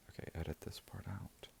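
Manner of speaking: hushed whisper to the editor. Real, not acted. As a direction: drop to a breathless whisper. Aside to the editor mid-video.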